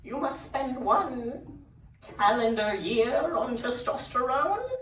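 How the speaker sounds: high, slow, drawn-out proclamation; bridge troll. Retold or imitated. Troll doctor sets second trial, slowly and grandly. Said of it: "he says".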